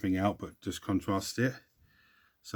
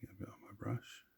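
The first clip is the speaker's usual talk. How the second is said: breathy unvoiced aside; whisper; 16 dB quieter than usual. Real, not acted. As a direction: whisper it under your breath. Muttering to self while painting.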